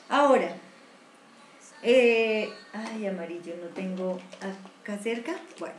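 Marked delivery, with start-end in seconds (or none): none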